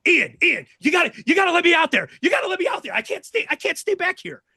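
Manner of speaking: high, fast, shouted begging; frantic pleading. Retold or imitated. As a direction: beg to be let out, wired and desperate. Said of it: "he's like".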